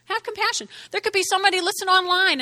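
normal talking voice

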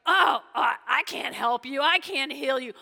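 loud mock panic; freaking out. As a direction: blurt it loudly like a panicking king. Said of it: "he's freaking out because he's like".

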